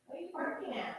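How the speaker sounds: high, soft voice for the dog; talking to a pet. Real, not acted. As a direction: coax the dog, high and hushed. Asking dog softly.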